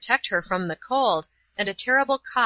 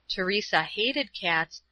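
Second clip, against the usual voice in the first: usual speaking voice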